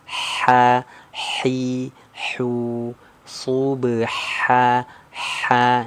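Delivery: "Ha, hi, hu" with airy throat h; breathy small ha. Shown as a demonstration. Teacher drilling the light h for students. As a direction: let the air flow out on each h. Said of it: "yang ha pedas".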